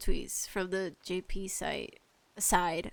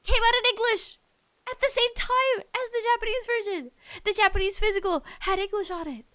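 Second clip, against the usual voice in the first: exasperated; 10 semitones higher than usual. Real, not acted. To publishers who never promoted the game, exasperated disbelief. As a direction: vent the disbelief.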